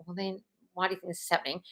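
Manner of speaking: ordinary talk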